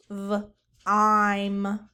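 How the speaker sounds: "V-ime" split into v and a long ime; segmented sounds. Shown as a demonstration. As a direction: stretch it apart as V-ime. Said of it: "What about vime?".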